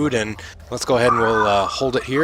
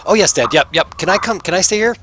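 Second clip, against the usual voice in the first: put-on high kid voice; 7 semitones higher than usual. Kid begs his dad.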